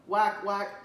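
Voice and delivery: loud mock quacking; duck quacks. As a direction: quack like a proud mother duck. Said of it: "Mama said".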